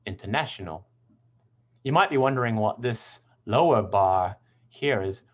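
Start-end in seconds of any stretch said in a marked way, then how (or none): 0.1-0.9 "international" stressed on the third syllable, inter-NA-tional. Shown as a demonstration. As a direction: lean on NA in "international". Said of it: "it's on the na syllable".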